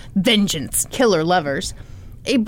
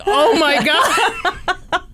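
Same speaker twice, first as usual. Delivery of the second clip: shocked; 7 semitones higher, much slower than usual. Retold or imitated. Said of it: "I'm just like".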